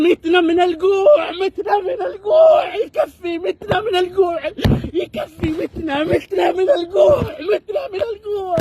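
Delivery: slow, crying shout of despair; angry crying. Real, not acted. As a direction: wail it out, starving and furious. Protester crying about starvation.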